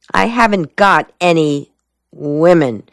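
usual speaking voice

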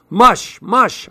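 mock shout. A narrator's reading. Boy driving lizards with a tiny whip, high mock shout. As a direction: call it out high like driving a team. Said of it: "I shouted".

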